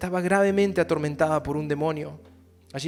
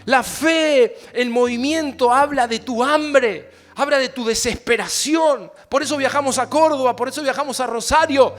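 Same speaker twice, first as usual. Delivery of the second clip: fiery preaching; 7 semitones higher, 12 dB louder than usual. Acted. Pastor shouting to his congregation, fiery preaching. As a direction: preach it out to the church.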